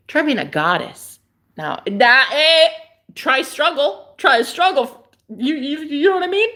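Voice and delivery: loud, high, dramatic sass; diva rant. Pretend. Ranting to viewers about goddess struggles, loud and dramatic. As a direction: act like a suffering goddess.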